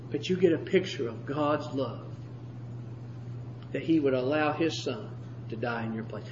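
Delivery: low, soft pulpit appeal with long pauses; reverent, subdued sermon close; hushed preaching. Acted. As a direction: let each phrase sink in quietly.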